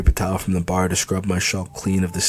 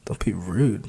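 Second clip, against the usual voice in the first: breathy whisper, rising on rude; 5 dB quieter than usual. Acted. She repeats his words to herself, whispering. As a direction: whisper it back to herself, puzzled.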